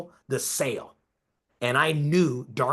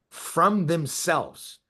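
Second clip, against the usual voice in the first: slow emphatic repeat; 2 semitones higher, much slower than usual. Real, not acted. Slowed repeat of key phrase.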